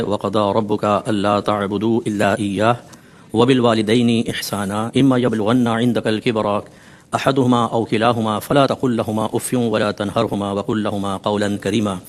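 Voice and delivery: measured Arabic recitation of a verse; Quran recitation. Acted. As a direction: recite the verse with reverent, steady cadence.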